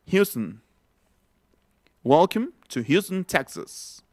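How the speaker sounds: Houston as "HYOO-stn" inside an English welcome; reduced English Houston. Shown as a demonstration. Teacher showing how it would sound, English pronunciation demo. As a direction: say Houston without the O, first syllable stressed. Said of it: "Así sonaría".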